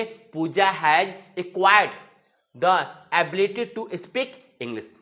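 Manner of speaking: very slow, word by word; slow dictation. Shown as a demonstration. Teacher modelling an English sentence, very slow. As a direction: spell the sentence out slowly for learners. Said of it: "तो क्या बोलेंगे?".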